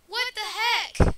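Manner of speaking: high, loud, slow disbelief; baffled exclaim. Real, not acted. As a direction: drag it out, baffled. Confused by game event.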